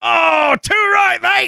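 put-on high shouted English accent. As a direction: shout it like an Aussie-English bloke. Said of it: "your English accents".

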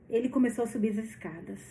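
ordinary delivery, nothing marked

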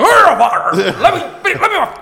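high-pitched, stammered mock protest; flustered protest. Man on the mop bucket pleading with the cleaner, flustered. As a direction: put on a strained, high plea, stumbling over it.

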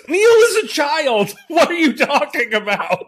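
high, loud incredulous yell breaking into laughter; high-pitched comic protest; mock outrage. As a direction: shout it in laughing disbelief.

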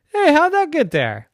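high, loud puzzled question; baffled and surprised. Acted. Bear surprised.

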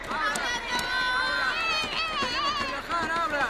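very high, straining shout; desperate yelling. Acted. Father demanding the bus open up, high desperate shout. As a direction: yell to be let in.

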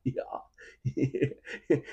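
ordinary delivery

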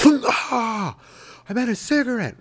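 high squeaky put-on voice. Bar guy correcting himself, high squeaky voice. Acted dart-bar exchange.

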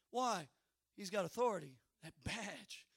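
ordinary talk

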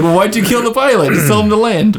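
high-pitched imitation of baffled complainers; mock protest. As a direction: put on a squeaky-high objecting voice. Mimics characters' objection to the killing.

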